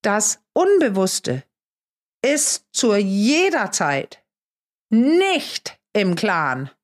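very slow, phrase by phrase with pauses; deliberate, heavily spaced statement; emphatic. Real, not acted. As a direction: hammer the point home slowly. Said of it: "Ich kann es nur betonen".

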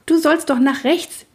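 put-on high, insistent child rider; scolding instruction. A narrator's reading. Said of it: "wies Greta den kleinen Schecken an". (hoch und ungeduldig anweisen)

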